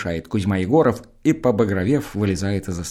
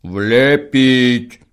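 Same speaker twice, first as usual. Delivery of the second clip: deep bass; 4 semitones higher, 8 dB louder than usual. Acted. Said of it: "бас Михайла таким низким голосом".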